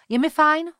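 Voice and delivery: high, loud, quick automatic reply; blurted automatically. Acted. High automatic blurt to the doctor's greeting. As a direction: blurt it out by reflex. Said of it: "vyhrkla jsem automaticky".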